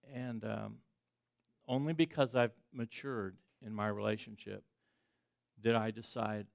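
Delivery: ordinary delivery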